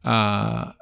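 usual speaking voice

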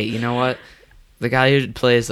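usual speaking voice

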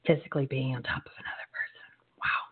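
hushed scandalized whisper. Real, not acted. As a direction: whisper it, half shocked. Shocked aside whispered.

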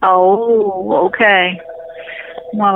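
ordinary delivery

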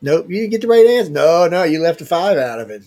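host gleefully tells the viewer they missed; playful loud no. Real, not acted. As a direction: call it out like a game show buzzer. Telling a viewer they lost.

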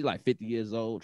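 usual speaking voice

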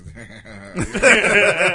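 hammed-up supervillain cackle; evil chuckle. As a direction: laugh like a cartoon villain. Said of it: "He's giving that super evil villain laugh".

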